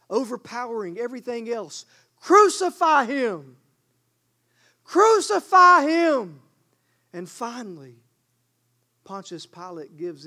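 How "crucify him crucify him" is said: slow, high, very loud chant of the crowd; mob shouting. Retold or imitated. Preacher voicing the crowd overpowering Pilate, building shout. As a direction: bellow it like a mob drowning out everything. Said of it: "they start shouting with one voice".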